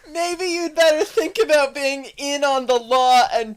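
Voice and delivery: loud preachy cop voice lecturing the suspect; mock cop lecture. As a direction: scold like a smug officer. Mocking Nancy the cop character.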